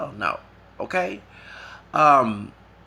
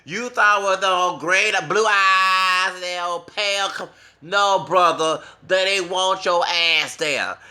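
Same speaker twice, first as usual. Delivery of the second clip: high, loud and drawn-out taunt; loud gloating mockery; 7 semitones higher, 8 dB louder, slower than usual. Real, not acted. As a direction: crow over the rejected man, stretching the words. Mocking the rejected man after laughing.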